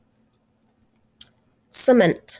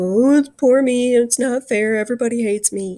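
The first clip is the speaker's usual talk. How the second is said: mock crying wail, loud then tailing off; hammed-up poor-me blubber; self-pitying whining; 5 dB louder than usual. Shown as a demonstration. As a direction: whine as if crying for yourself. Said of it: "that's the kind of crying that you do".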